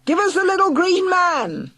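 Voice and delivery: high, loud chant of a mob; yelling crowd. Acted. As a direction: yell it as the mob. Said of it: "they yelled".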